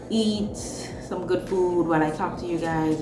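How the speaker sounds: ordinary talk, nothing marked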